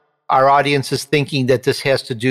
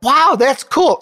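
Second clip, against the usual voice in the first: amazed; 10 semitones higher, slower than usual. Retold or imitated. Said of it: "And I was like".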